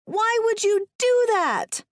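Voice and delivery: high, loud, slow outcry; crying out. A narrator's reading. Woman to trapped man on the ice, crying out. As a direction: cry out in appalled disbelief. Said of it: "I cry out".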